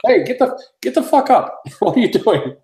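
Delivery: high-pitched mock indignant yell; retold confrontation. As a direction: call the customer out, high and incredulous. Acted confrontation with customer on floor.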